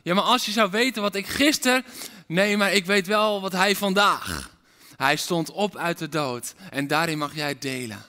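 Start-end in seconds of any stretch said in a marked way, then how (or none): 0.0-4.5 loud, fervent preaching; forceful preaching. Acted. Preacher rousing the congregation, loud and fervent. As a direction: preach it out with fire.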